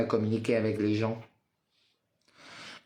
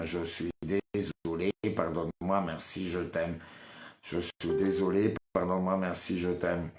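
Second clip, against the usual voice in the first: repeated mantra; 3 semitones lower than usual. Acted. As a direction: murmur it like a prayer.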